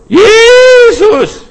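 huge high shout of the name; calling out. Shown as a demonstration. Preacher showing the congregation how to call, full shout. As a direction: cry the name out with all your voice. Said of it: "ruf noch einmal".

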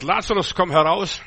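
loud, commanding call to come out. Retold or imitated. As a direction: call Lazarus out of the tomb with authority. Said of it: "und sagt". (lauter, gebietender Ruf)

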